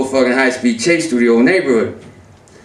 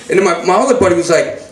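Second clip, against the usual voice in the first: ordinary talk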